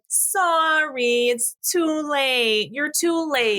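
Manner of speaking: high, slow, drawn-out refusal; registration desk lady. Acted. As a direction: stretch it out like an officious pageant lady. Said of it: "the lady at the registration desk is like".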